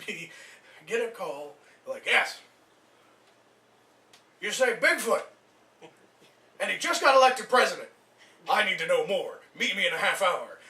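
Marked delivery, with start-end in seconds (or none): 2.1-10.6 loud, eager phone reply; excited Ventura. Acted. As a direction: jump on the news, loudly. Eager Ventura taking the call.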